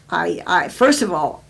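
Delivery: ordinary talk, nothing marked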